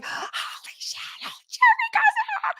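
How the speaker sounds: starstruck friend's high squeal, breathy then shrieked; imitated excited squeal. Friend spotting a rock star in the elevator, imitated excited squeal. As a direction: draw out a very high, gasping fangirl shriek. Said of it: "who's very loud".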